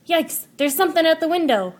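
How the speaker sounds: high, loud startled fear; scared child at night; frightened. Acted. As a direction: yelp it in panic.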